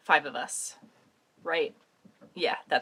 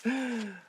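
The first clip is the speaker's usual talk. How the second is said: surprised gasp; 5 semitones higher than usual. Real, not acted. Opening a surprise package.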